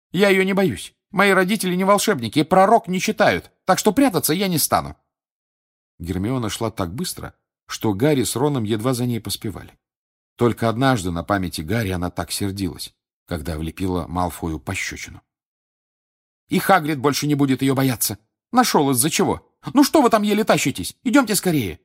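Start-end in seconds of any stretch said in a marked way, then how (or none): 0.1-4.9 defiant. A narrator's reading. Hermione defiant and angry. 16.5-21.8 impatient. A narrator's reading. Hermione hurrying friends.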